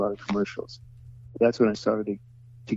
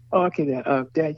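son's voice; 6 semitones higher than usual. Retold or imitated. Voicing the son directing.

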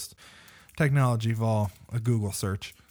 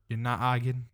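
ordinary talk, nothing marked

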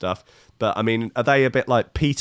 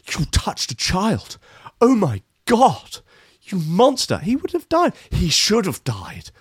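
hammed-up horror, higher and drawn out; mock outrage; 8 semitones higher, slower than usual. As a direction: accuse them with theatrical shock. Acted outrage at imagined child-grabber.

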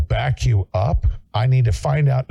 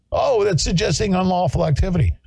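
higher, prim voice parroting a critic; a touch of prissy mockery; mock objection; 9 semitones higher than usual. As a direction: lift the pitch and play the fussy objector.

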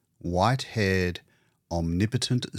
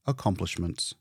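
usual speaking voice

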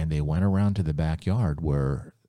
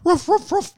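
very high loud ruffs; dog Clyde barking to get in; dog barks; 6 dB louder than usual. Acted. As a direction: bark at the door. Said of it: "He barks".

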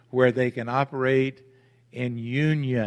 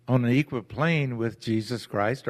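ordinary talk

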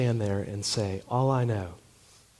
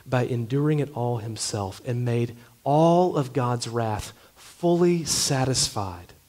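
ordinary talk, nothing marked

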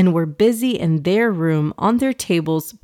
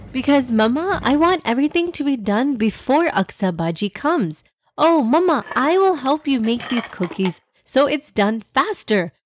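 put-on young girl voice; 6 semitones higher than usual. A narrator's reading. Daughter voiced in story.

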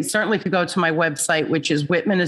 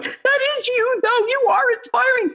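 much higher, moved and tearful; friend crying. Retold or imitated. Friend at breakfast telling her, high and tearful. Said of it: "my friend's now crying, going".

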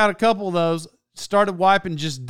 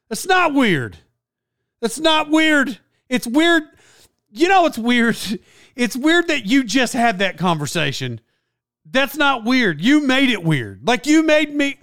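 higher, emphatic mock outrage; exasperated retort; 6 semitones higher than usual. Annoyed bystander corrects the chatty guy, exasperated. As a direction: push back in comic exasperation.

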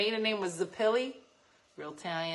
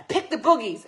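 spring the prank with a jump in volume; sudden gross-out punchline; 4 semitones higher, 7 dB louder than usual. Retold or imitated. Grandpa springing the booger trick on grandkids, suddenly loud. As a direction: burst out loud after the hush.

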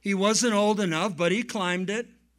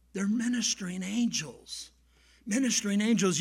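ordinary talk, nothing marked

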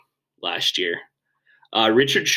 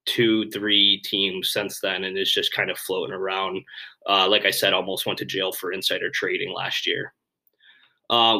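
usual speaking voice

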